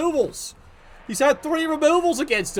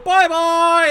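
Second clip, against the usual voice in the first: loud taunting bye-bye; mocking farewell; 6 semitones higher, 7 dB louder than usual. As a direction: wave them off with glee. Mocking a removed player.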